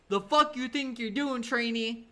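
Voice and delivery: imitating a sergeant who thinks he's mocked; mock angry sergeant. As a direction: drill sergeant confronting a trainee, mock angry. Drill sergeant snapping at recruit.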